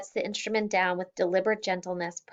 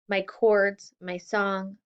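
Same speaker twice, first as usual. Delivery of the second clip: ordinary delivery, nothing marked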